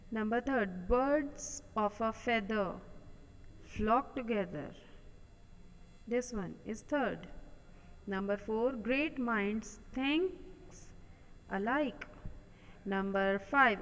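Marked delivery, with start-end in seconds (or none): 0.9-4.7 slow dictation. Shown as a demonstration. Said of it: "reads the proverb slowly". 8.9-12.1 slow dictation. Shown as a demonstration. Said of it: "reads the proverb slowly".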